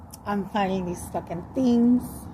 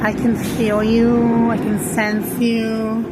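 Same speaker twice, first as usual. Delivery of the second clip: slow, stretched-out words; drawn-out calling; slower than usual. Retold or imitated. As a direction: call out slowly to sense an unseen presence. Calling out to the beings.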